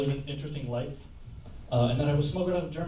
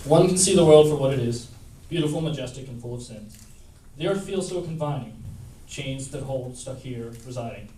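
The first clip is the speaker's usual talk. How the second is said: poetry reading with loud emphatic swells; poem recital; 6 dB louder than usual. Acted. Open mic reader performing her poem to the audience, emphatic recital.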